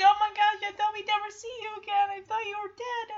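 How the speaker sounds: high, gushing relief; emotional reunion. Acted. Friends reunited after fearing death, high mock-emotional gush. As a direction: pour it out in a high voice. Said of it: "It's like".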